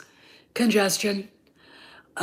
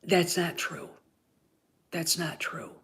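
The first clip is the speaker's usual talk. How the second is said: gentle reassurance; 3 semitones lower, 7 dB quieter than usual. Pretend. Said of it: "taken her to the side and said".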